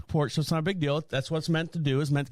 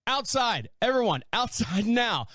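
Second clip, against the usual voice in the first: retold yell; 6 semitones higher than usual. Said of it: "they would yell and say".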